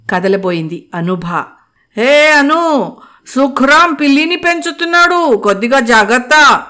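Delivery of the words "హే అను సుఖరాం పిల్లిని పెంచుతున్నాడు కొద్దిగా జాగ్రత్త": mock warning, higher and louder; teasing. Mukul teasing Anu about the cat, playful provocation. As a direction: tease her playfully. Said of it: "కవ్వింపుగా".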